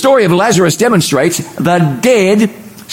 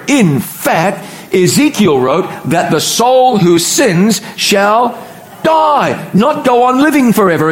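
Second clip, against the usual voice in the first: impassioned preaching; 4 semitones higher, slower than usual. Real, not acted. Preaching builds.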